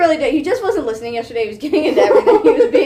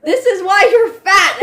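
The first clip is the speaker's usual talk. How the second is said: mock shout; 6 semitones higher, 5 dB louder than usual. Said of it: "when she's like".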